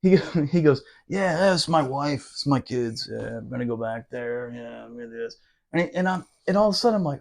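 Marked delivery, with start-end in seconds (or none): 1.1-5.4 a frightened sergeant trailing off to nothing; scared mutter. Retold or imitated. Sergeant talking about his wife and kids, scared, fading to a murmur. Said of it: "This guy is scared".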